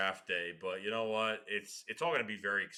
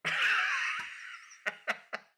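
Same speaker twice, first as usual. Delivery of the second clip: burst of laughter; 4 dB louder than usual. Real, not acted. Finds friend's gaffe hilarious.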